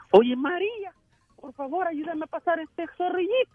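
high voice for José. Retold or imitated.

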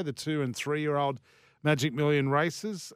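normal talking voice

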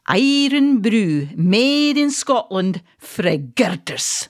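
put-on quoting the ad in a light Scottish accent; lightly voiced Scottish ad line, slow; a hint of Scottish brogue; Scottish slogan. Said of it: "the slogan was".

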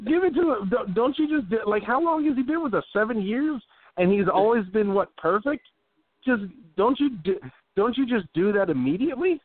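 high-pitched, insistent pleading; high incredulous rhetorical questions; insistent urging. Real, not acted. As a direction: push the case in a high, worked-up voice. Arguing to pay the long snapper.

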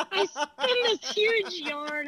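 amused confession, drawn out through laughter; laughing. Real, not acted. Owning up to a tall tale told to the driver, laughing.

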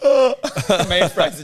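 slow, laughing gasp into the words; recalling being turned away at the border. Real, not acted. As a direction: laugh as you relive the shock. Retelling being denied entry, laughing.

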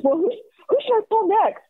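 very high, rushed, giddy imitation; excited teenager. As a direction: race ahead eagerly in a high voice. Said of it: "like an excited teenager".

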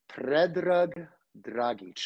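Predrag Dragic said slowly in the Serbian way, rolled R; native name pronunciation. Shown as a demonstration. Guest telling the host his real name, careful Serbian pronunciation. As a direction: give "Predrag Dragic" its true Serbian sounds, slow and careful. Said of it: "That's the way you would say it".

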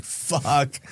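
soft, dropped curse under the breath; muttered curse. Retold or imitated. Stuck at the parking gate, a soft defeated curse. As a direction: let it fall out quietly in defeat.

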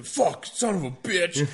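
high, drawn-out imitation of angry cussing; mock swearing. Hunter imitating the sliding dude, mock swearing. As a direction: laughingly imitate someone cursing as he slides. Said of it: "fucking swearing".

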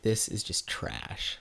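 disgusted. Retold or imitated. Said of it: "and I'm like".

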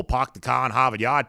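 mock Boston accent, r's dropped; quick put-on Boston accent. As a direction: imitate the Boston catchphrase accent. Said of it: "people from Boston don't have strong accents".

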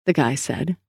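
usual speaking voice

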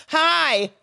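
high, drawn-out hello; showy phone greeting. Acted. Caller to sister beside a film star, showy high greeting.

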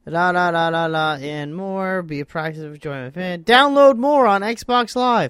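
loud, slow hammed-up ad read; announcer voice. Reads box blurb in announcer voice.

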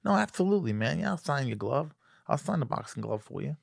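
soft, high Tyson imitation; Mike Tyson. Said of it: "He goes".

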